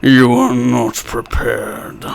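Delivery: slow, low, menacing villain voice; drawn-out dark threat, deep and grand; Liam O'Brien villain voice. Pretend. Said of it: "my Liam O'Brien".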